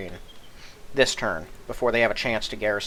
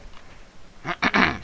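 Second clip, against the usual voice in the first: rough clearing of the throat; 7 semitones higher than usual. Real, not acted. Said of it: "Sorry about my, my voice".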